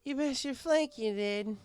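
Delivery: high, slow tipsy glee; high, slow drunk mare giggling; giggly drunk. Acted. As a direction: giggle it out, proud. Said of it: "she giggled".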